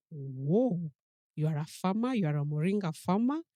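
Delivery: light up with delight at the news; amazed exclamation. Retold or imitated. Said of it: "I'm like".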